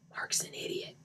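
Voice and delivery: unvoiced whisper; whispered aside. Shown as a demonstration. Narrator mocking Mark, whispered aside. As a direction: whisper it under your breath.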